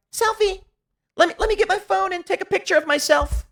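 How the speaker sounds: very high, put-on voice mocking a woman online; high-pitched parody of tearful vanity; mock crying selfie-taker. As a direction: squeal it like a self-absorbed crier. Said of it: "She's crying, acting like something bad is happening".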